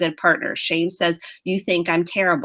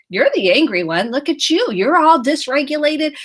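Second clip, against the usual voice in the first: louder, rising accusing retort; defensive accusation; 7 semitones higher, 5 dB louder than usual. Retold or imitated. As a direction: turn the blame back on them. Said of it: "I wanna get defensive".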